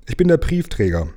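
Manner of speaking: high, mildly pitiful voice; slightly plaintive. A narrator's reading. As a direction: answer a little woefully from the dark. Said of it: "ein wenig kläglich".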